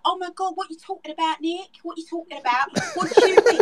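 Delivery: high, incredulous British talk-show guest; hammed-up British accent, demanding an explanation; British trash-TV accent. Shown as a demonstration. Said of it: "the entire morning show in this accent".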